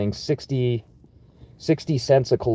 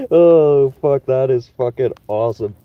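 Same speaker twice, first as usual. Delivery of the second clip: normal talking voice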